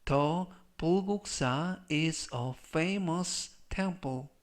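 slow, separated phrases for learners; slow model reading. Shown as a demonstration. Teacher models the temple sentence for learners, slow model reading. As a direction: read it slowly as a language model sentence.

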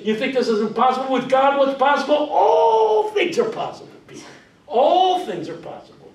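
high, loud, stretched preacher cry; full preaching. Shown as a demonstration. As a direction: lift ALL and hold it. Preaching demo.

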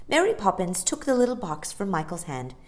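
ordinary delivery, nothing marked

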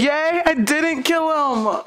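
slow, loud joyful cheer; excited shout. Real, not acted. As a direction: cheer it out with relief. Joy butterflies hatched.

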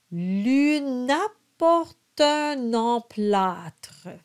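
slow, careful French: monta with a nasal O; slow French model. Shown as a demonstration. Coach reads the verse slowly for students. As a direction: sound out each French syllable. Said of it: "we do the liaison".

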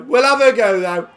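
excited repeat of the shot call. Real, not acted. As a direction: lift it with excitement. Excited second take.

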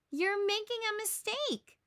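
urgent little believer; Linus calling out. A narrator's reading. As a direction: warn them as they pass. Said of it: "Linus cries".

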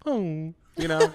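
mock whimper.

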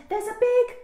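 put-on high, loud, urgent child's call. A narrator's reading. Daisy tries to warn Mum, calling out loudly. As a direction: blurt the news out at the top. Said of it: "Daisy called".

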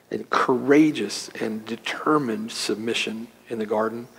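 prayer. Acted. Pastor praying for the congregation, hushed and reverent. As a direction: pray it hushed and reverent, letting lines fade.

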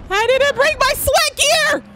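high, loud, slow wailing complaint; panicked wail. Real, not acted.

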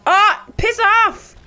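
high panicked yelling; being chased. Real, not acted. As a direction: shriek in real panic while fleeing. Attacked by monster, begging.

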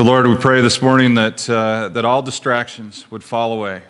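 ordinary delivery

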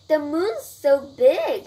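slow, loud wonder; amazed. Acted. Brian thinks as he wakes, amazed.

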